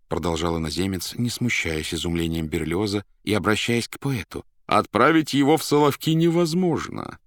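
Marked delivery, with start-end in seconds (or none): 4.7-7.2 high, loud pronouncement; emphatic. A narrator's reading. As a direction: declare it impossible, pitched up and loud. Foreigner emphatically answers the poet. (громко и категорично)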